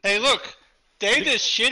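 heated arguing. Real, not acted. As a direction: argue loudly over your teammates. Heated argument over match scores.

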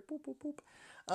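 high playful beeps; boop sound effect. Pretend. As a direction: squeak out little beeps.

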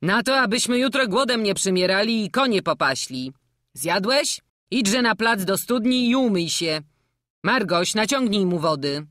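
put-on a jovial old jester coaxing the boy; hearty old showman. (dziarski stary kuglarz, głośniej)

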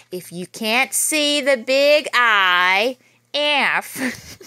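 lively read-aloud storytelling voice; story narration. A narrator's reading. Teacher reading a spooky school story to children, lively. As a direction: read it out big and lively for the kids. Reads picture book to kids.

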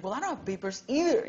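imitating his own gleeful relief; excited confession. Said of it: "Why are you so excited?".

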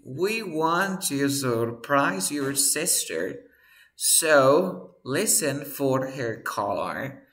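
"We want to surprise your sister" read out slow and deliberate; reading aloud slowly. Shown as a demonstration. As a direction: leer la oración despacio, palabra por palabra. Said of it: "Dice aquí". (lectura lenta de ejemplo en inglés)

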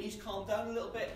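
normal talking voice

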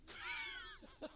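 very high, wheezy giggle; real laughter. Real, not acted. Preacher amused by his [name] joke, wheezy giggle. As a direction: giggle helplessly at your own joke.

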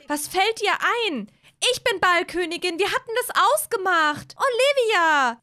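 high, loud scolding voice; angry quarrel. Acted. Hazel confronts Olivia over the crown, angrily. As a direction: accuse her, pitched right up. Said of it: "das wird sie Olivia jetzt auch sagen".

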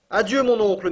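loud, high farewell call; crying out. A narrator's reading. Axel cries farewell up to his uncle, loud high. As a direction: call up the shaft to him. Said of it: "m'écriai-je".

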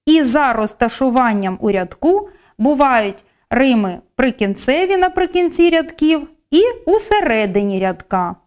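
ordinary talk, nothing marked

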